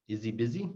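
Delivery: "Is he" said as "izzy" before "busy"; dropped H. Shown as a demonstration. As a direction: link "Is he" as one word, H silent. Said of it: "The H sound disappears".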